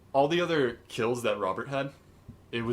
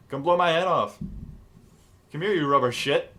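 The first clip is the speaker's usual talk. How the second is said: louder, lightly imitated woman luring someone out; mock woman's voice; 4 semitones higher, 4 dB louder than usual. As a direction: call it out as a half-hearted female impression. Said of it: "needs to be a woman's voice".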